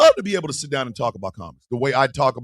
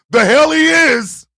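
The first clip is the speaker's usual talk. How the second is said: scoffing shout; 9 semitones higher, 5 dB louder, slower than usual. Real, not acted. Scoffing rebuttal to chat.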